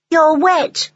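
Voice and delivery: put-on a child stating the obvious; small boy. A narrator's reading. Rowley noticing Henny is soaked, in a high child voice. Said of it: "he said".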